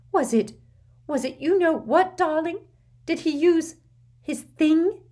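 high hushed anxious voice; fearful Aunt Petunia. Acted. Petunia whispers fearful question.

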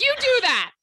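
high and loud, fading at please; exasperated retold shout. To her husband Andrew, a tearful loud mock send-off. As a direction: send him off loudly through tears. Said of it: "I was like".

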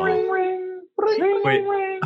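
high ringing phone noise; phone ring imitation. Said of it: "I'm gonna answer the phone".

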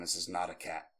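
low, quiet mysterious voice; voice in the head. Retold or imitated. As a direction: intone it like a telepathic message. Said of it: "heard the voice in my head".

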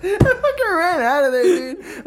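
high, slow, laughing and breathless; after laughing hard. Real, not acted. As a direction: squeeze it out through laughter. Cracking up telling it.

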